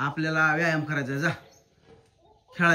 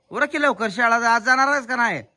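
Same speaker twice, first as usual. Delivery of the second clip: high, raised hurry-up; urging loudly; 6 semitones higher, 6 dB louder than usual. Real, not acted. Parent hurrying child to get ready for school, urging loudly.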